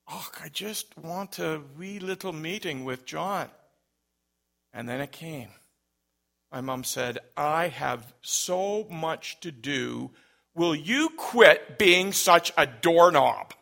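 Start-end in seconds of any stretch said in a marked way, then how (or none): 0.1-3.5 soft-spoken imitation of a Scottish caller; thick Scottish accent. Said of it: "had a very thick Scottish accent". 7.4-13.5 slow exasperation building to a loud scold; really frustrated mom. Retold or imitated. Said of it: "Now she's getting really frustrated".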